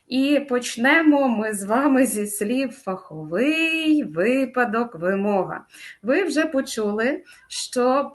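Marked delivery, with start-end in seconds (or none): 2.7-4.0 "фаховий" with the last syllable drawn out; stress on final syllable. Shown as a demonstration. Teacher introduces stress words to students, "фаховий" stretched on its last syllable. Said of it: "на перший склад наголос падає". 4.0-4.9 "випадок" with ВИ stressed; stress on first syllable. Shown as a demonstration. Teacher lists stress words for students, "випадок" stressed on ВИ. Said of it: "на перший склад наголос падає".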